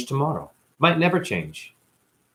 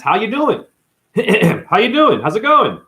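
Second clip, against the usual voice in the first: higher, louder mock greeting; store clerk greeting; 9 semitones higher, 5 dB louder than usual. Store clerk asking how he's doing, higher mock greeting. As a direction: imitate an upbeat, higher-pitched how-are-you.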